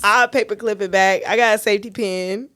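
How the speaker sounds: loud, high and giggly; laughing. Real, not acted. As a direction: talk while cracking up. Joking, both cracking up.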